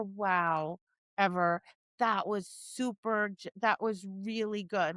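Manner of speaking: low, soft, gentle praise; understated and soothing, keep the voice down; calm praise. Shown as a demonstration. Trainer saying well done to her dog, calm and low. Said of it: "calmly saying to her".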